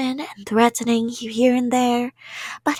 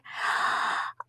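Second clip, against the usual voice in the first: gasp of sudden idea; 9 dB quieter than usual. Acted. Yandere gets an idea.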